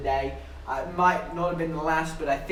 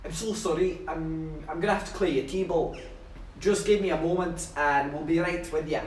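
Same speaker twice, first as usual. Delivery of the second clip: posh Scottish apology; mock posh Scottish waitress. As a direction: put on a genteel Scottish accent. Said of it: "I'll have to do the posh Scottish voice again".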